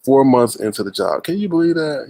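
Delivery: normal talking voice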